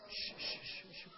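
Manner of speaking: unvoiced hushing shh; shushing. Preacher acting out Joyce hushing the room. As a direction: hush everyone urgently. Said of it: "here's what she did".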